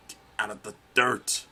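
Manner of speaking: loud push onto DIRT; frustrated command. Real, not acted. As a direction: urge the character on, punching the last word. Yelling at own game character.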